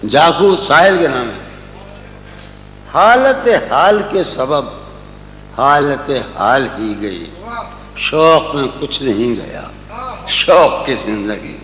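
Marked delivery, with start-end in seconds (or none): 2.9-11.6 slow, lingering ghazal recital; tender romantic poetry. Acted. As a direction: savour each line of the couplet. Recites his ghazal at the mushaira.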